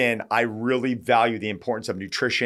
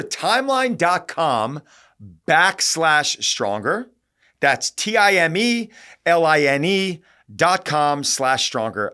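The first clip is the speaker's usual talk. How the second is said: slow, higher-pitched web address read-out; deliberate spelling-out; 6 semitones higher, 4 dB louder, slower than usual. Real, not acted. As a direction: spell it out slowly, letter by letter.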